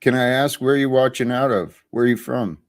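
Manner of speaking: ordinary talk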